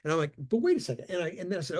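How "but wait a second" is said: higher, objecting mock protest; a young tech balking, pitched up; startled protest. Retold protest to the surgeon.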